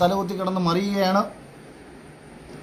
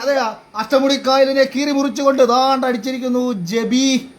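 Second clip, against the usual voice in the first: excited countdown; 8 semitones higher, slower than usual. Real, not acted. Giveaway countdown and winner reveal.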